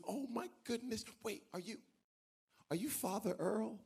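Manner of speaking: hesitant, wondering recognition, lightly acted; mildly astonished. Lady at the gym recognising the pastor, mildly astonished.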